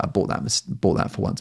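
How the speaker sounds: hushed sheepish whisper; whispered confession. Real, not acted. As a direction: whisper it like a guilty admission. Confessing under breath.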